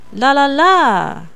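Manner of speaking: last "la" high and drawn out, gliding down; slow la's, the final "la" sliding down; slide down. Shown as a demonstration. Teacher echoes the sentence melody, final "la" sliding down. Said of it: "like a slide down in pitch".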